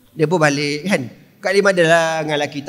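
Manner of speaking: usual speaking voice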